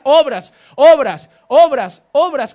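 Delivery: each OBRAS punched loud; emphatic, drawn-out preacher's refrain; hammered repetition. Real, not acted. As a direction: hit every "obras" hard, falling away after. Hammers home a repeated word.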